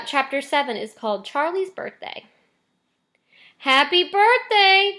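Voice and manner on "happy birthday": much higher, slow, loud cheer; joyful cry. Acted. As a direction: greet him with delighted old voices. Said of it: "cried the four old grandparents".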